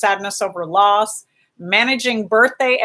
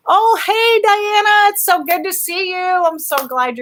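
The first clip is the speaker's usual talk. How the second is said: excited greeting; 11 semitones higher than usual. Real, not acted. Greeting a returning viewer in chat.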